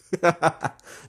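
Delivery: high, taunting laugh; mocking laugh. Real, not acted. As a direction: laugh it off at them.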